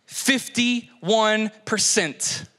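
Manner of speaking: FIFTY, ONE, PERCENT drawn out with pauses; deliberate pauses splitting "Fifty-one percent"; slow, emphatic repeat. Real, not acted. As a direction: land "Fifty-one percent" very slowly, word by word. Repeats statistic slowly for impact.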